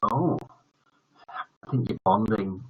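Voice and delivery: ordinary delivery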